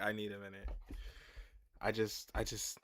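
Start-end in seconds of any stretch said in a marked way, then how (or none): none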